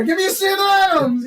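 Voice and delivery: high, shouted mock drink order; hammed-up sitcom regular; bar regular ordering. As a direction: bellow the order across the bar. Acted regular orders his beer.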